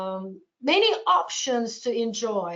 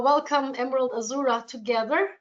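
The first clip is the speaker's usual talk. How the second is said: usual speaking voice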